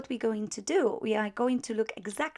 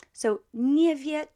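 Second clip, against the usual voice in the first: nie said higher and louder, slowed; stress on nie; 5 semitones higher, 7 dB louder, slower than usual. Shown as a demonstration. Teacher showing a student where negation goes, stressing nie. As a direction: lean on nie to show the negation. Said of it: "nie before the verb".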